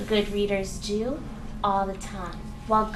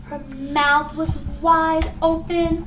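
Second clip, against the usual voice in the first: louder, slower, wide-eyed telling; excited emphasis; 6 semitones higher, 7 dB louder, slower than usual. Shown as a demonstration. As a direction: paint the excitement big. Describing excited face.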